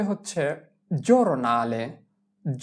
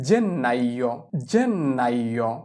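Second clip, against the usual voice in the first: slow model pronunciation; 5 semitones lower, much slower than usual. Shown as a demonstration. Teacher modelling Italian for January, slowly drawn out. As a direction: draw out জেন্নাইও, lingering on each part. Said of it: "জানুয়ারিকে বলা হয়".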